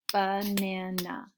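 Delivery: slow ba-na-na in three beats; clapped syllables. Shown as a demonstration. Teacher sounding out banana slowly for kids. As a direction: draw Banana out in three claps. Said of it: "Let's clap this out".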